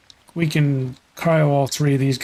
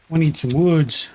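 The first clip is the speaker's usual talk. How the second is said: usual speaking voice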